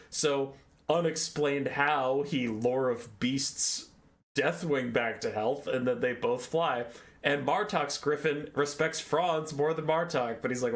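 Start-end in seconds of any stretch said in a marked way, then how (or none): none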